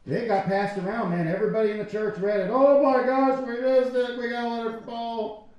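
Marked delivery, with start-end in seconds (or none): 2.5-5.5 higher, louder mock gasp of excitement. Churchgoers thrilled by a fake Paul letter, mock excitement.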